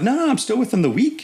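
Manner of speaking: very high, startled protest; defensive protest. Retold or imitated. Lieutenant protesting to the clerk, high and defensive. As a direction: yelp it out like you've been caught. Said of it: "I'm like".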